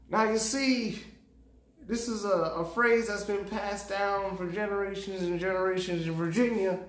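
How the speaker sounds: put-on a Virginia storyteller spinning an old saying. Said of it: "This is a Virginia voice".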